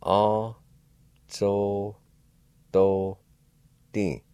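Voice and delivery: A, so, do, Din, each syllable said apart; slow teaching syllables A so do Din. Shown as a demonstration. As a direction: split Azototin into A, so, do, Din. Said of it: "it's pronounced like this".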